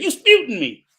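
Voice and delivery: loud grandma imitation; imitating grandmother. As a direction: play grandma insisting. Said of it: "And she said".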